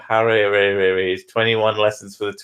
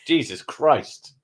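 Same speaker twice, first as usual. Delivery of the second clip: ordinary talk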